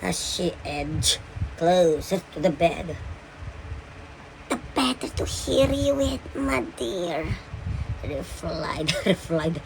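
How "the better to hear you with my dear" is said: lightly voiced wolf, pitched up high; storybook wolf. A narrator's reading. Wolf answers Red about its ears, lightly disguised high voice. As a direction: put on a high pretend-grandmother wolf voice, easing off at the end. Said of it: "replied the wolf".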